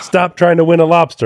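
mock screamed order at ordinary volume; retold screaming bartender. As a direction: imitate an exasperated bartender. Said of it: "the bartender just starts screaming".

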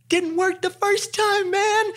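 very high, slow squeal; mock panicked protest. Man arguing with his own brain on a date, mock panicked. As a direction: squeal it in comic desperation, stretched out.

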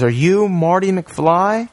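high, slow, probing question; slow questioning stranger. Retold or imitated. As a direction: draw the question out slowly. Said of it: "And he says".

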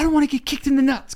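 high-pitched protest, mimicking a yelling husband; imitated yelling. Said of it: "he's kind of yelling at me".

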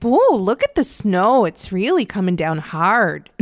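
put-on slow drawled regional accent, voice lifted on "Whoa"; put-on local accent. Joking how she'd really say it, in a slow regional accent. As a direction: lean into that regional accent, stretching the words. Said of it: "really drop into that accent".

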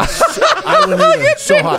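high, slow words through laughter; laughing. Real, not acted. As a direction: laugh as you speak. Laughing at Nick's struggle.

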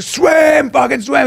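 high, urgent yelled command; retold urgent shout. Boy caught in the current, urgent shout. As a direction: yell it like egging on a swimmer. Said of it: "So he's like".

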